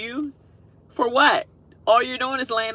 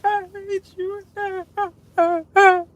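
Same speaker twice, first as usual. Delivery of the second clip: put-on high wailing child's sob, drawn out very slowly; mock child crying; 14 semitones higher, much slower than usual. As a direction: whine it like a spanked seven-year-old. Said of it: "crying like he got the worst butt whooping".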